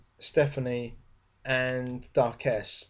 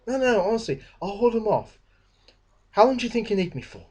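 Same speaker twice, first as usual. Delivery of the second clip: a higher, keen voice that rushes to volunteer; eager Fletcher; 8 semitones higher, faster than usual. Retold or imitated. Fletcher eagerly volunteers to stay with the Amazonian women, in a higher, keen voice. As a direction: Fletcher pipes up, far too keen to stay behind.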